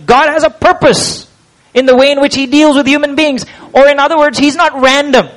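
preaching. Acted. Preacher driving home God's plan to the congregation, rising. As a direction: build it up like a pulpit climax.

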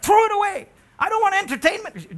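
loud high indignant rejection; indignant refusal. Retold or imitated. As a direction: snap it away with disgust. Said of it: "He's like".

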